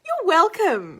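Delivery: high sing-out that swoops down; mock-sweet reply. Waitress answering the customer's thank you, exaggerated courtesy. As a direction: play up the courtesy, starting high and falling.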